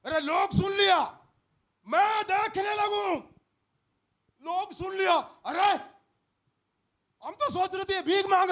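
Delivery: loud, high astonished exclaiming; amazed crowd. Acted. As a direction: gasp it out to each other. Crowd marvelling loudly.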